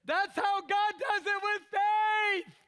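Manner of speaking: high, slow, loud shout; booming preacher's cry; shouting preach. Real, not acted. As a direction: proclaim it loudly.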